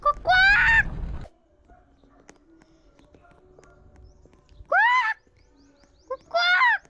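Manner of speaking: high, loud quacks shouted out; duck quacks. Pretend. Kid in a duck game quacking loudly at friends.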